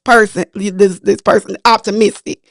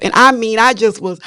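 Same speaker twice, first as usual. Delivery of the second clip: ordinary talk, nothing marked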